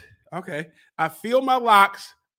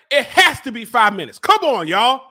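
normal talking voice

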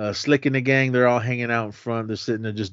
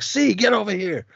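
much higher, odd mock voice calling a friend; imitated call; 11 semitones higher than usual. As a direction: yank him over to the fight. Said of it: "they're like".

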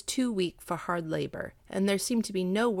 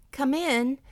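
higher, raised call; 7 semitones higher, 4 dB louder than usual. A narrator's reading. Widow answering a knock at the gate, calling out. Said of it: "the widow Wang called out".

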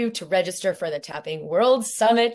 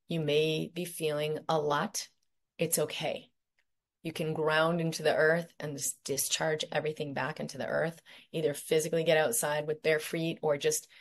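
guided meditation. Acted. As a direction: lead them gently through each point.

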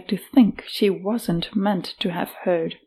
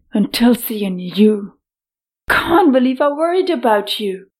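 angry outburst; 3 semitones higher, 4 dB louder than usual. Acted. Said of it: "She growled".

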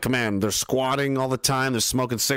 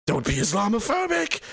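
high-pitched mock reproach; exaggerated imitation of a scold; 8 semitones higher than usual. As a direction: parody a prissy objector, voice pitched way up. Mocks a scolding objection.

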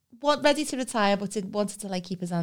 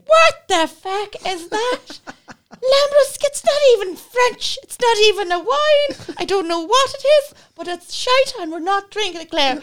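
much higher and louder, slow outraged rant; outraged teacher; 14 semitones higher, 10 dB louder, slower than usual. Retold or imitated. Wine teacher rejecting Claire's Lambrusco, outraged loud rant. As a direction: explode in disgust at the bottle. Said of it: "he was like".

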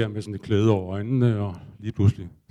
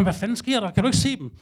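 panicked; 9 semitones higher than usual. Retold or imitated. Panicking at the shaman about bees.

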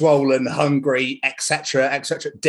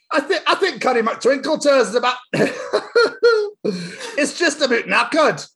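high Scots voice, slowing, cracking up; laughing Daddy Pig; 10 semitones higher than usual. Acted. Reading Daddy Pig line aloud, laughing through Scottish voice. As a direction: try to get through it laughing. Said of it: "said Daddy Pig".

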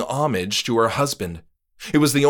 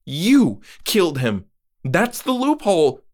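much higher, louder, breaking through; shocked realization; 8 semitones higher, 4 dB louder than usual. Acted. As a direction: she just got it. Moira realizes.

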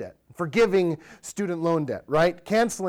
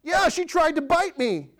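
put-on high kid voice, defensive; child's retort; 11 semitones higher than usual. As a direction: play the other sibling snapping back. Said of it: "I go talk to the other child".

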